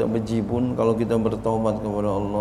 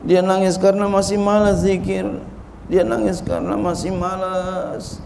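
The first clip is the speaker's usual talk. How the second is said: insistent preacher, building in pitch and force; emphatic preaching; 3 semitones higher than usual. Real, not acted. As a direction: hammer each repeated phrase home, rising as it goes.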